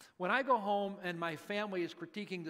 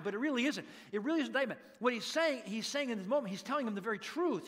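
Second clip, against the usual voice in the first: usual speaking voice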